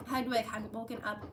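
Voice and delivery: plain reading voice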